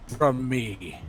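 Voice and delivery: booming giant demand. Acted. Giant threatens.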